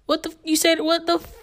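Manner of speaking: high-pitched retold challenge, cut short; confrontational challenge. Girl lighting up her middle-school bullies, mock confrontation. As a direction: act out squaring up to someone.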